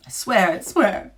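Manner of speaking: loud, slow, sobbing oath; tearful plea. A narrator's reading. Weeping Duke's son swearing to the witch. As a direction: blurt it through tears.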